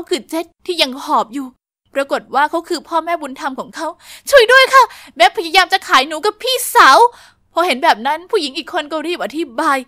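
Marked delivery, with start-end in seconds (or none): 4.3-7.0 cry for help. Acted. Girl calls to police.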